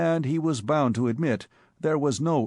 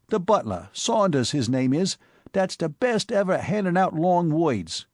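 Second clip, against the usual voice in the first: higher, brash New York street voice; Bowery boy Spike; 5 semitones higher than usual. Acted. Spike breathlessly telling his boss about the cop, in a higher brash Bowery voice. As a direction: play the excitable Bowery tough.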